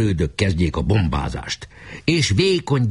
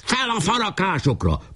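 high-pitched commanding cry; urgent high command; battle order; 11 semitones higher than usual. Acted. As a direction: send them up, voice pitched high. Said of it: "Pásztor újra parancsot adott".

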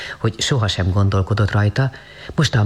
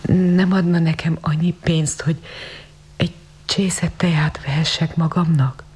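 high, timid, hesitant begging; halting plea; 6 semitones higher than usual. A narrator's reading. Girl asks the lady for tea money, halting. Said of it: "hebegte akadozva".

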